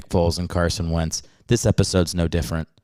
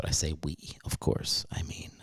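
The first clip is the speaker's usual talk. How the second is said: whispered aside; 5 semitones lower, 13 dB quieter than usual. Real, not acted.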